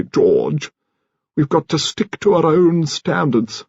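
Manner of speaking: put-on an elderly aristocrat laying down the family standards; grand old lady. A narrator's reading. Elderly lady addresses George.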